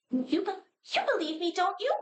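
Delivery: very high-pitched pleading doll; high little voice begging desperately; begging puppet. Acted. Puppet begs to be killed.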